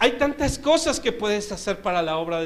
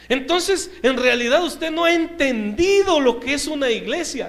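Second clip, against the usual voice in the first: preaching; 3 semitones higher, 5 dB louder than usual. Acted. Pastor lectures the Sunday-only member, preaching loudly. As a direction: preach it like from the pulpit.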